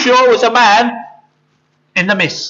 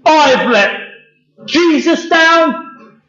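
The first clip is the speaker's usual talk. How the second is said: drawn-out, higher-pitched lament; fed up; 7 semitones higher, much slower than usual. Acted. Peter laments letting Jesus down, fed up. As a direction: moan it slowly, stretching the last word.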